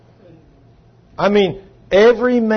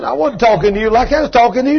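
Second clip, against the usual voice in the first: mock protest; 6 semitones higher, 3 dB louder, faster than usual. Acted marital quarrel, husband protesting.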